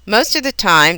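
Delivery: normal talking voice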